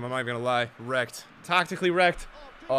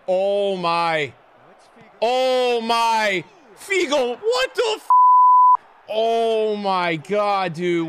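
drawn-out cries climbing higher and louder; building astonishment; 6 semitones higher, 8 dB louder, much slower than usual. Real, not acted. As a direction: build from stunned to yelling in disbelief. Stunned by Figo's goal.

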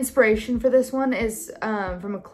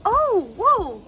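high, coaxing voice for the puppy; dog-talk voice; 8 semitones higher than usual. Real, not acted. Telling the dog Savannah to sit, in a high pet voice.

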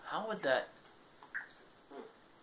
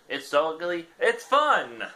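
chirpy, louder read of the blurb; excited ad read; 6 semitones higher, 7 dB louder than usual. Acted. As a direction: sell the ugly fish like an ad. Reading the item description aloud.

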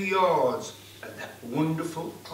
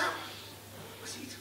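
plain reading voice

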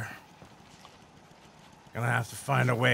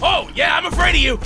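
defiant shouting; 13 semitones higher, 6 dB louder than usual. Real, not acted. Fighting a monster, yelling defiance.

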